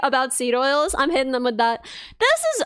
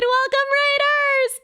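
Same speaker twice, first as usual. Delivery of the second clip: excited welcome; 10 semitones higher, slower than usual. Real, not acted. Greeting a raid.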